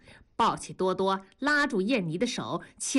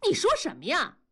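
indignant cry; 8 semitones higher, faster than usual. A narrator's reading. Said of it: "梅梅就喊".

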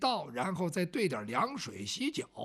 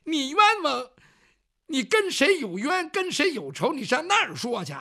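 higher, louder, indignant questioning; indignant loud challenge; 5 semitones higher, 8 dB louder than usual. Acted. Man confronts the pot's ghost, loud and indignant. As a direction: fire questions back at the ghost.

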